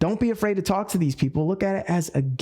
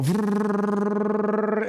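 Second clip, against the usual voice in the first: scrolling noise; 4 semitones higher than usual. Retold or imitated. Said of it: "just go".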